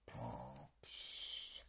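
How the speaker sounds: snore, then puff out air; sleepy snore and sigh; snoring. A narrator's reading. Animals snoring.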